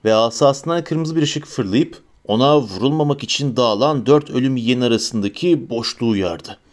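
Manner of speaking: usual speaking voice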